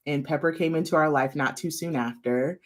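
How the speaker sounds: ordinary delivery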